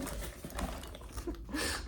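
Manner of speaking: breathy high gloating laugh; high cackle. Acted. Mocking victims, a breathy high cackle. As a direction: let out a tiny high cackle.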